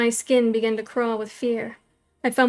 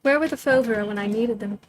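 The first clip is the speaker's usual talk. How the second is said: usual speaking voice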